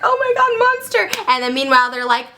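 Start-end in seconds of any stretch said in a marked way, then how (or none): 0.0-1.1 high-pitched overjoyed greeting; mock excited outcry. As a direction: cry out to the returning monster. Frankenstein greets returning monster.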